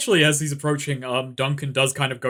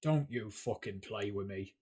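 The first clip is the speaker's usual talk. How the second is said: low menacing threat; 6 semitones lower, 14 dB quieter than usual. Acted. Threatening the advisor in character.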